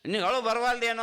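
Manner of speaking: the question flung out high and loud; loud pleading question. Retold or imitated. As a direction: press Krishna hard for an answer. Arjuna's question repeated louder.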